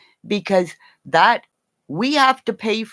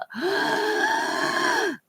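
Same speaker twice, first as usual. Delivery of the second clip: high squeaky gasp of delight; squealing mock gasp. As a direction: gasp like you've seen a treat.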